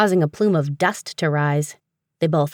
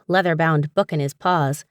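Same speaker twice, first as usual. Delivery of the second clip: usual speaking voice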